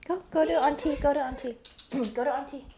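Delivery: high, very soft pet-coaxing voice. Real, not acted. Host sending the dog to auntie, soft coaxing.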